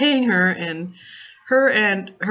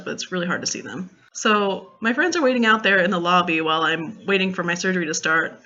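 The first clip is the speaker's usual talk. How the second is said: normal talking voice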